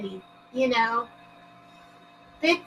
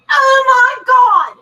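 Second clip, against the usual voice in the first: loud, high, drawn-out gasp of shock; 7 semitones higher, 8 dB louder, slower than usual. Real, not acted. Streamer shocked by a chat message, shouting. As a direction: blurt it out in real disbelief.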